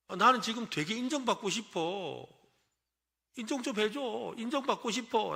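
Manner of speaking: mock pleading. Shown as a demonstration. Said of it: "라고 하라는 거예요".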